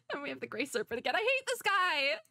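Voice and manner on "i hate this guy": high, whining complaint; high-pitched, drawn-out whine; whiny exasperation. Real, not acted. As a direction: groan it high at the hated villain. Villain appears in the game.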